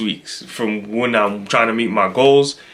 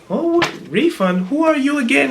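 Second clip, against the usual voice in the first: imitated clueless clerk; 13 semitones higher than usual. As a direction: mimic her having no idea who is calling. Voicing the forgetful company woman.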